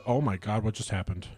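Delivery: usual speaking voice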